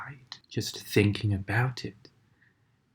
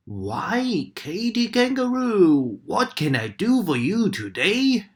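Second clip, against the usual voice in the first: lightly voiced crocodile, higher; a hint of a big friendly crocodile; 9 semitones higher, 4 dB louder than usual. A narrator's reading. Crocodile greeting the kangaroo, lightly voiced.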